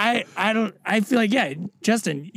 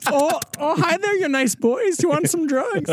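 high-pitched Minnesota-nice greeting; hammed-up Midwest accent, pitched up; 9 semitones higher than usual. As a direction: put on a chipper Midwestern accent. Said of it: "like, a Midwest".

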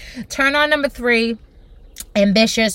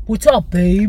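lowered imitation of a smooth guy; bassy man's voice; 4 semitones lower, slower than usual. Host showing the voice she wants in a man, lowered. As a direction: drop it down for some bass. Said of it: "I need some bass".

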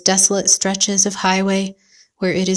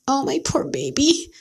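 crying out; 5 semitones higher than usual. A narrator's reading. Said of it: "I cried".

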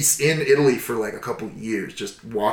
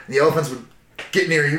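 ordinary talk, nothing marked

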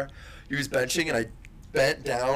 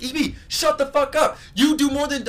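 mock yell, pitched up high; retold yelling; 10 semitones higher, 4 dB louder than usual. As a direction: re-enact yelling at a friend mid-game. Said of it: "yelling at him".

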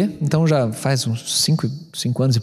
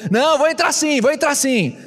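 high retold insistence; 9 semitones higher than usual. Retold stubborn insistence, argument.

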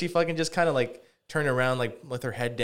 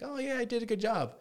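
high-pitched voice for a pleased dog; put-on high doggy voice; proud dog's voice; 12 semitones higher, 3 dB quieter than usual. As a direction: voice the dog, high and self-satisfied. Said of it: "she was, like, looking at me like".

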